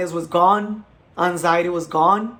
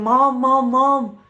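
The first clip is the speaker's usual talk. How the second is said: calling for mom, louder and higher; retold yelling; 5 semitones higher, 4 dB louder, slower than usual. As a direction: call out for mom in a panic. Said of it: "I started yelling".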